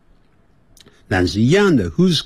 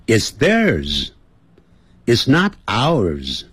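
慢速示范 It's theirs; 一字一字清楚念; slow model answer; much slower than usual. Shown as a demonstration. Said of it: "再来讲一遍". (放慢示范省略说法)

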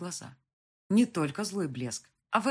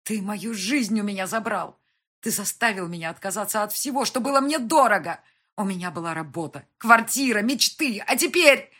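building from raised voice to high shouting; anguished accusation; 5 semitones higher, 11 dB louder than usual. A narrator's reading. Wife blaming him for her lost life, building fury. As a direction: accuse him, louder and higher as it goes.

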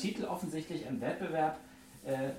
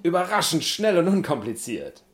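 loud, higher teleshopping pitch; loud ad read; 4 semitones higher, 9 dB louder than usual. Pretend. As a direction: shout the selling points.

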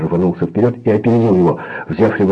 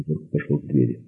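ordinary delivery, nothing marked

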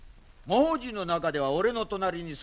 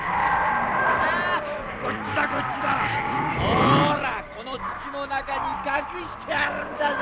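high coaxing calls; 妖怪をおびき寄せて大声で呼ぶ; luring shout; 6 semitones higher than usual. Acted.

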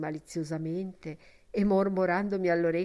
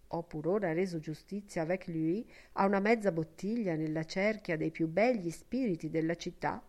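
plain reading voice

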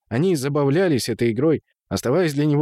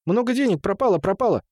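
high, agitated gabbling; high distressed lament; 9 semitones higher than usual. A narrator's reading. Темул mourns his spilled money, high unhappy babble. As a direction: wail the loss in a much higher voice. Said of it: "Он с несчастным видом".

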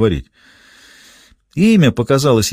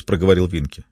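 usual speaking voice

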